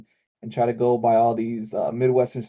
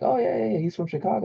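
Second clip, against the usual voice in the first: much higher mock voice, eager yeah yeah yeah; imitating an eager introducer; 9 semitones higher, 3 dB quieter, faster than usual. Mocking a friend introducing him at parties, in a high eager voice. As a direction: play a friend showing him off, lightly mocked. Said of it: "they'll introduce me like".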